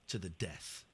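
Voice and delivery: very low, soft mock menace; mock-solemn murmur; mock-grave confirmation. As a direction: drop low and quiet like a pretend duellist. Mock duel challenge to caller.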